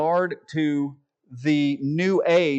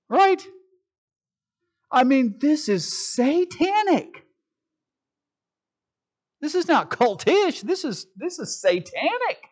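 indignant; 10 semitones higher, slower than usual. Real, not acted. Indignant preaching outburst.

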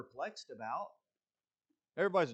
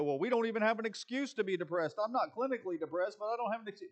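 higher and faster, a mock whiny believer; whiny excuse; 7 semitones higher, faster than usual. Believer mocking their own lack of excuse, higher and faster. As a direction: play the self-doubting believer, quick and high. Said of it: "And we go".

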